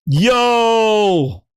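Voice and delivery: high, long drawn-out yo; Satya, calling out. Acted. Imagining the CEO at the next show, calling out. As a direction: hail the crowd with one stretched word. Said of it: "he's gonna be like".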